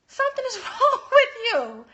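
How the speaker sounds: loud, high, with a laugh; loud amused scolding; laughing disbelief. Real, not acted. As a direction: laugh it off as an obvious fib. Reacts to the spouse's "Nothing.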